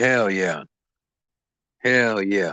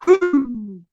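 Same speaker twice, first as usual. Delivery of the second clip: laughing whoop; 3 dB louder than usual. Real, not acted.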